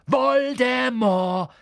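high, loud, slow bellow; roaring the name. Acted. Harry shouting the name at Hagrid, high and loud. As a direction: hurl the name out defiantly. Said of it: "brüllte Harry".

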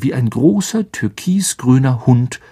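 usual speaking voice

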